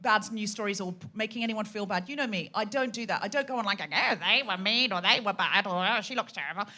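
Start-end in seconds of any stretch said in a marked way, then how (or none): 3.8-6.7 softer, put-on whining gossip voice; hammed-up sing-song carping; mock catty gossip. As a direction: mimic someone griping about others. Mocking catty gossip she avoids.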